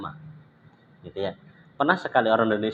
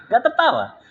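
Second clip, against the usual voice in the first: high protest. Real, not acted. Protesting on the student's behalf.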